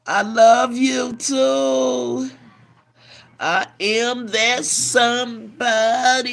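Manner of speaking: drawn-out loud goodbye; loud and slow. Real, not acted. Saying goodbye to a caller. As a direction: call it out slowly.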